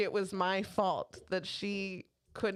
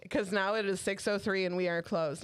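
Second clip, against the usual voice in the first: ordinary talk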